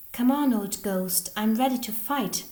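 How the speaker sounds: challenging shout. A narrator's reading. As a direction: call the ghost out to fight. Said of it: "shouts Samuel".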